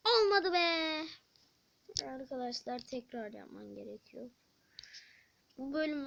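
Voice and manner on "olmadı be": frustrated. Real, not acted. Game attempt fails.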